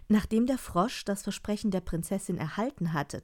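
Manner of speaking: usual speaking voice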